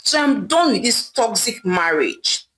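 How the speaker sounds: plain reading voice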